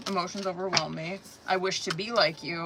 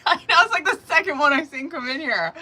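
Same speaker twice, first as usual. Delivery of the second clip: laughing; 8 semitones higher, 6 dB louder than usual. Real, not acted. Laughing at card coming up.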